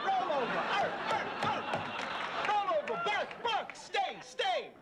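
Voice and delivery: high, excited dog-trainer orders and yaps; mock dog commands. Host puts co-host through dog tricks, high mocking commands.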